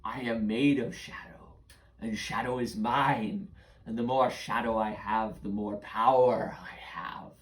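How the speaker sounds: boastful, menacing creature voice; shadow creature. Acted. Shadow creature boasting to children whose shadows he took, menacing and drawn-out. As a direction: gloat with drawn-out words, ending in a whisper.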